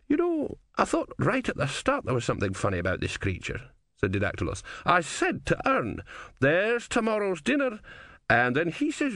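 pleased with the takings; a scruffy old rogue, high and gleeful; grubby old philosopher. Acted. Didactylos retells his claim.

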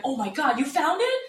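put-on astonished question; mock surprise. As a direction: pretend amazement, rising high. Imagined prank reply to scammer.

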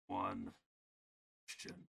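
very low, hushed evil voice; distorted evil voice. Acted. Evil voice interrupts Nick mid-question, low and hushed. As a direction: drop deep and soft, sinister. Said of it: "before you finish that statement, and says".

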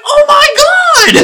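very high, loud, drawn-out squeal; fangirl shriek. Retold or imitated. Fan spotting a favourite actress in credits, excited shriek. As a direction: shriek it slowly with starstruck delight.

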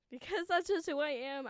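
normal talking voice

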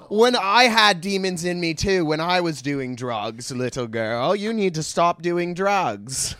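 hammed-up British accent, swooping high; badly faked British celebrity preaching; Russell Brand. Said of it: "that's a terrible, uh, Russ-, sorry, Russell Brand".

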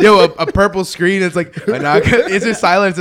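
giggling through it; trying not to laugh. Real, not acted. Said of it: "I'm trying to be serious".

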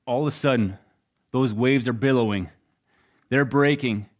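ordinary delivery

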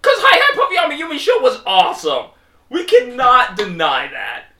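loud, high, fast insistence; excited insistence. Real, not acted. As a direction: insist it with a grin. Defending the show loudly.

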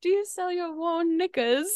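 put-on sweet little Brit asking, British accent. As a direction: giggly repeat in a British accent. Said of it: "So I'm gonna say it in an accent".

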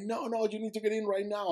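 high, insistent command; urgent order. Retold or imitated. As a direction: reenact a panicked boatman's order. Boatman's urgent order, retold.

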